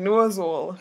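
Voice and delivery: "Nose hole" as "nooz ool", h dropped; patois nose hole. Shown as a demonstration. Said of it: "So say it again".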